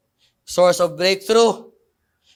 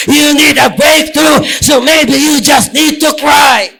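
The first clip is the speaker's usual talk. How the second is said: a loud, slow, high shouted altar call; impassioned revival preaching, shouting slowly; preaching at full force; 4 semitones higher, 8 dB louder, slower than usual. Acted. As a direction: cry it out to the whole congregation. Preaching climax, crying out.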